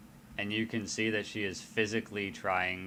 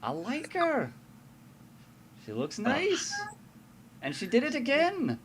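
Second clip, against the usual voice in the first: much higher pouting protest; much higher pleading wife voice. Acted. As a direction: whine that she did nothing wrong. [name] protesting, delighted.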